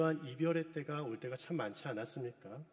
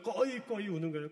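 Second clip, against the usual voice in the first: 꺼이꺼이 voiced higher as a sobbing wail; mock wailing; 4 semitones higher than usual. Shown as a demonstration. Pastor showing the congregation how 꺼이꺼이 sounds, mock wail. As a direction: act out 꺼이꺼이 like choking sobs. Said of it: "목이 멜 정도로 막 우는 거예요".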